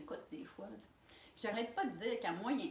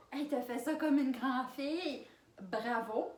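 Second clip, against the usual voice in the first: usual speaking voice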